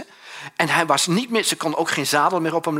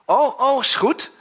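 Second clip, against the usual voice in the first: friend on phone; 10 semitones higher, 5 dB louder, slower than usual. Retold or imitated. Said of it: "belt op naar een vriend".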